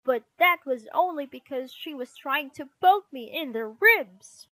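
put-on indignant boy's loud retort; brother shouting back. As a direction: shout your excuse at your sister. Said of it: "shouted Brother".

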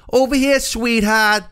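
slow, high Boston diner waitress; Boston accent. Retold or imitated. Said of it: "she kinda had a Boston accent".

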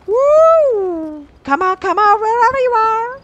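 high, loud sing-song taunt. Real, not acted. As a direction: call out to the hidden enemy like a game of hide and seek. Taunting enemies while hunting them.

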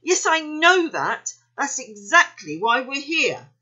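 high, loud, exasperated reply; quite upset. A narrator's reading. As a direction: retort with rising impatience. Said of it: "Missus Gray was quite upset".

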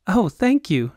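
high breathless gasp of thanks; a touch of a grateful gasp. Acted. Woman thanking Roland for freeing her lover, gasping. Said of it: "she gasped".

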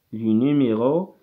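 le numéro said very slowly and clearly; slow dictation. Shown as a demonstration. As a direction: stretch le numéro for the class. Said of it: "repeated slow dictation".

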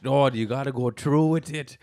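hammed-up, shaky-grasp Irish accent; comic try at Irish; attempted Irish accent. Shown as a demonstration. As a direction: put on a bad Irish brogue. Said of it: "No, that's Irish".

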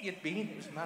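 usual speaking voice

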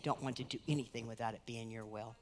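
normal talking voice

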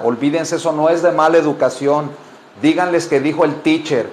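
ordinary talk, nothing marked

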